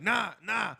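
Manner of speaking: loud high no; retold refusal. Young boy refusing to give up his candy, loud. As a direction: push back loudly. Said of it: "and I'm like".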